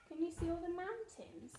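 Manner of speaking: plain reading voice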